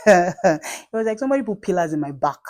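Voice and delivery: gleeful laugh as she tells it. Real, not acted. Vlogger laughing while telling viewers about her dad.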